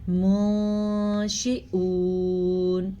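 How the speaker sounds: منشئون with a gentle hamza and long oon; slow recitation; soft hamza. Shown as a demonstration. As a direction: ease softly through the ء in منشئون. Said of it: "کیسے".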